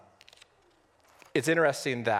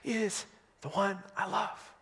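high, slow, aching plea; a pleading cry for the one she loves; desperate longing; 9 semitones higher, slower than usual. Retold or imitated. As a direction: ask it like she's lost everything. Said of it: "she's so desperate".

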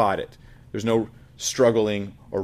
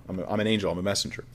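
ordinary talk, nothing marked

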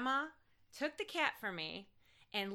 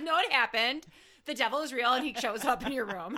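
louder, matter-of-fact mother; louder imitation of their mother; mother's voice; 3 semitones higher, 7 dB louder than usual. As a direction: state it flatly like mom did. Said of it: "she was like".

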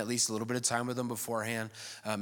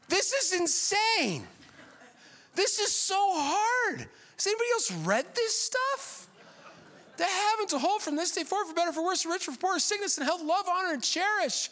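much higher, hammed-up exasperated voice; mock exasperated. Pastor mocking his own wedding script, high hammed-up exasperation. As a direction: whine it like the vows are impossible. Mock-overwhelmed reading of vows.

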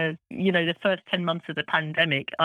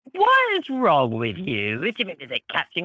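indignant; 4 dB louder than usual. Retold or imitated. Retorting to a prejudiced remark.

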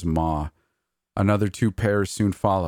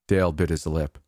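ordinary delivery

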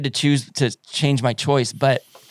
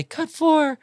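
very high-pitched mimicking voice; rough high-voiced impersonation; Rodney impression; 13 semitones higher than usual. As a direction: pipe it up high, imitating someone. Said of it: "he has a high-pitched voice like that".

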